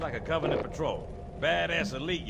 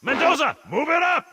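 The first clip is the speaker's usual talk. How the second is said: higher, louder command to a soldier; barked order; 11 semitones higher, 6 dB louder than usual. Acted. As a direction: push him forward, urgent. Sergeant orders squad forward.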